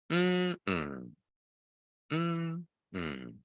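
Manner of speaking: soft high-then-low hum; wordless hum stepping down in pitch; hummed stress pattern. Shown as a demonstration. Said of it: "So this one is lower".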